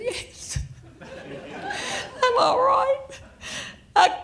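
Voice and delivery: high, breathless laughing reply; a laughing teen answering; laughing through it. Retold or imitated. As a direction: squeak it out between laughs.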